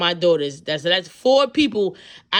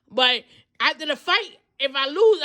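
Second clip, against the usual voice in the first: normal talking voice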